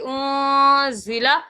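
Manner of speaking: اون ضلع with a held nasal اون and a short ضلع; nasal hold on اون, crisp ضلع; اون ضلع done right, slowly; correct ikhfa. Shown as a demonstration. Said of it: "اس طرح ادا کرنا ہے".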